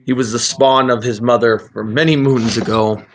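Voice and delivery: ordinary delivery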